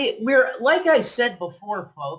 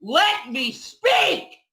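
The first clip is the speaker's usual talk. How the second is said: much higher, slow shout; angry yell; 7 semitones higher, much slower than usual. Real, not acted. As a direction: cut her off with a yell. Husband yells over wife.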